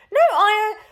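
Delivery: high, loud flustered stutter; stuttering denial. Acted. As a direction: trip over the denial, caught out. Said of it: "the lady stuttered".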